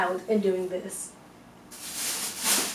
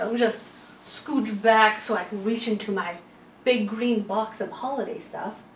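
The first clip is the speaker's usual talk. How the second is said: normal talking voice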